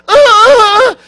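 sudden high, loud scream of pain. Retold or imitated. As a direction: yell out as if it hurts with a tube in the throat. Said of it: "And she's going".

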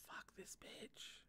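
slow breathy whisper, no voice at all; whispered curse. Real, not acted. Reader whispers her curse at the villain again. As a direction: whisper the curse slowly through the teeth.

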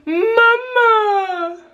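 put-on high, drawn-out whiny baby call; imitating baby. Mother mimicking her fussing baby, high and whiny. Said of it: "And then he went".

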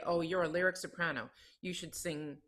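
normal talking voice

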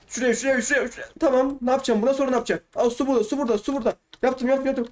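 high, breakneck self-talk; frantic excitement. Real, not acted. Player talking himself through a tricky jump, frantic and high-pitched.

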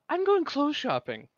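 high, breezy cheerful voice; breezy airy exit. Acted. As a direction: toss it off and leave.

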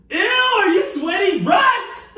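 disgusted. Real, not acted. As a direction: shriek it in disgust. Disgust at sweating.

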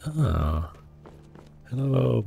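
normal talking voice

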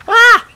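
very high loud scream; shrill scream. Real, not acted. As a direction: scream as it blows up. Caught in explosion.

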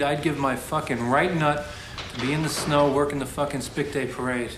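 loud venting. Acted. Bitter venting while waiting.

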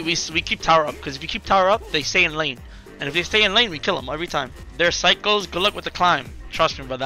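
ordinary delivery

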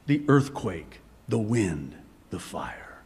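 softening to a low whisper by the last item; hushed fade. Real, not acted. Preacher lists where God is not, hushed and fading to a whisper. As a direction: let each item drop away, ending whispered.

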